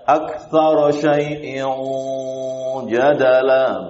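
aktsara chanted with a dark, heavy o ending; wrong aktsara. Shown as a demonstration. Teacher shows the mistaken heavy aktsara. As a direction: recite aktsara with a thick aktsaro. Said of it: "Jangan dibaca".

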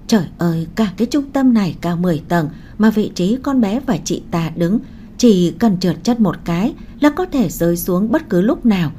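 ordinary delivery

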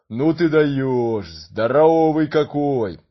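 slow booming amazed giant; gentle giant voice. Acted. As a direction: marvel slowly like a big giant. Giant marvels at the boy.

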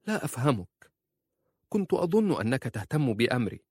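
high, wounded puzzlement; hurt, confused wife. A narrator's reading.

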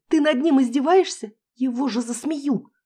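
high, loud outraged protest; indignant wail. Acted. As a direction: wail it in disbelief. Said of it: "взвыла Кася".

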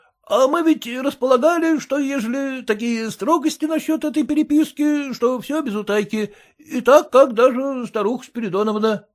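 bow and scrape to the master; humble clerk. A narrator's reading. Clerk's voice in story.

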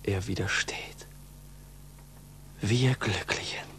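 whispered, barely breathing; hushed whisper. Acted. Pupil whispering in hushed joy as the bell stays silent. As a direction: whisper the joy as if afraid to break it.

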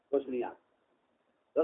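low, slow, hushed mock order; sarcastic hush in a low voice. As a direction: drop right down, drawn-out and quiet. Mock order to stay silent.

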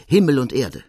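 loud, high shout of joy; jubilant cry. Acted. Larigny exulting to the listeners, loud joyful cry. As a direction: cry out in triumph. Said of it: "jauchzt Larigny auf vor Freude".

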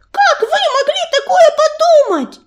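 very high, outraged shriek; indignant cry. A narrator's reading. Young cat answers an accusation of running away, high indignant cry. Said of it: "вскрикнула она".

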